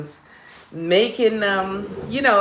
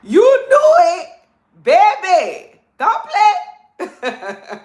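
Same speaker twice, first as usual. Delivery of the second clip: much higher, louder New Orleans sass with a laugh; playful New Orleans accent, laughing at the end; 12 semitones higher, 7 dB louder, much slower than usual. Pretend. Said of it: "heard her do a nola accent".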